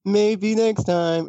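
high, slow, drawn-out musing; mock hopefulness. Player consoling themself over missed loot, mock hopeful.

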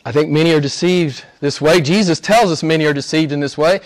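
ordinary talk, nothing marked

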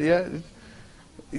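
ordinary talk, nothing marked

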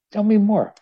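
eager plea. Acted. Snowman repeats eagerly, higher.

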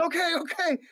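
a hint of the flustered driver; lightly voiced driver brushing him off; hurried driver. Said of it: "He goes".

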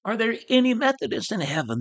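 a high, yearning call to the sky; crying out to heaven. Retold or imitated. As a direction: lift the voice up toward Abraham. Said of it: "cried out".

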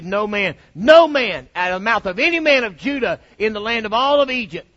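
builds to a booming shout; preaching shout. Acted.

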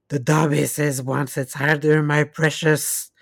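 ordinary talk, nothing marked

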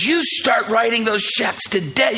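high, shouted order in imitation; furious wife. Wife ordering husband to start tithing, imitated furious shout. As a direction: play a wife laying down the law. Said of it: "Just rips into me".

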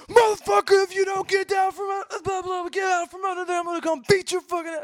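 high, loud furious screaming; re-enacted enraged scream, very high. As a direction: scream threats at the man under the van. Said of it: "I come out screaming".

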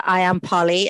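ordinary talk, nothing marked

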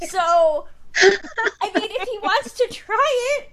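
breaking into loud laughter mid-sentence; laughing. Real, not acted. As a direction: laugh through the words. Laughing about boys' Cockney accents.

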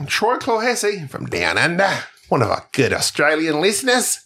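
mock Australian announcer-style introduction; Australian accent. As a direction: put on a playful Aussie accent. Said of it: "when I do that accent".

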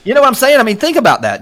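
rushed, high, keyed-up burst; fast excited rush of words. Real, not acted. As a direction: spill it out fast and high, fired up. Fired up about his journey.

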